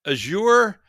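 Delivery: "Azure" as a-ZHOOR; second-syllable stress. Real, not acted. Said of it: "Is that how you pronounce it?".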